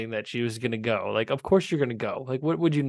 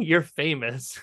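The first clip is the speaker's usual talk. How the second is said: normal talking voice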